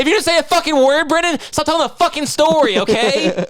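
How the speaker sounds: high, loud exasperated outburst at Brendan. Acted. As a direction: throw up your hands and yell it high.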